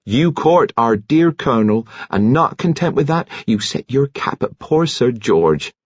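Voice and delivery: high-pitched indignant telling-off; scolding. A narrator's reading. Nurse accuses the woman of leading men on, scolding. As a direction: scold her in rising indignation.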